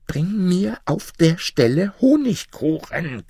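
very high, slow, shrill demand; shrieking. A narrator's reading. Wife shrieks at husband to fetch honey cake. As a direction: screech the order at him. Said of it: "kreischte Fatma".

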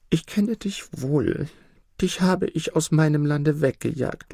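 lightly voiced emperor, moved and humble; grateful emperor. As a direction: speak humbly to the little bird. Emperor thanks the nightingale.

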